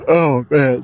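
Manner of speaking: words stretched by a yawn. Real, not acted. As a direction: say it mid-yawn. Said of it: "I'm over here getting a case of the yawns".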